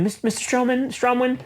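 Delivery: high, timid woman's voice; mock secretary. Secretary entering the boss's office, high mock voice. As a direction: squeak it like a nervous secretary.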